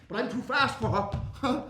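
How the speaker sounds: ordinary delivery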